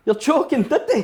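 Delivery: high, loud incredulous question; surprised disbelief. Retold or imitated. Asking the cyclist in shocked disbelief. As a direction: leap up in disbelief. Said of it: "I was like".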